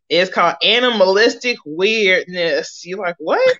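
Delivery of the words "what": puzzled. Retold or imitated. Imitated puzzled viewer.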